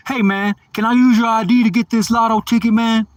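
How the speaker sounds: loud, high-pitched imitation of a hustler; imitated pushy stranger. Stranger asking him for his ID in a laundromat, pushy.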